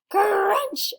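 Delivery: high, loud crunching shout. Acted. Sammy bites the story.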